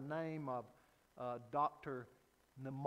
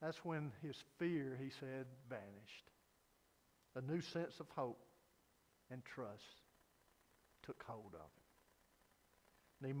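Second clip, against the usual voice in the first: soft and tender; 7 semitones lower, 10 dB quieter, slower than usual. A narrator's reading. Tender close of the story.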